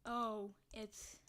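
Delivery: ordinary delivery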